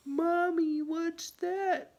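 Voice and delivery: put-on high, loud little kid calling; a small child calling to mommy. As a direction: pipe up like a curious toddler.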